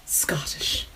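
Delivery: softly repeated word in put-on Irish; hushed attempt at an Irish accent. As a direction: say it quietly in a mock Irish accent. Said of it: "I said that in an Irish accent".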